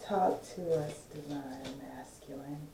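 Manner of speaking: soft, husky and seductive; sexy voice. Pretend. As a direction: purr it low and sultry. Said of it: "in my sexy voice".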